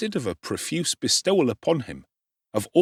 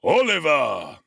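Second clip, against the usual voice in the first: solemn, drawn-out call through a keyhole; a beadle booming the boy's name; deep impressive tone; 5 semitones higher, 4 dB louder, slower than usual. A narrator's reading. Bumble calling through the keyhole to Oliver, impressive tone. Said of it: "said in a deep and impressive tone".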